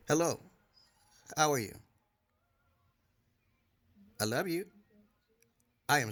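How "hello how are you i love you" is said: quiet, tender greeting to his wife; soft sweet talk. Real, not acted. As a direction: coo it softly at her.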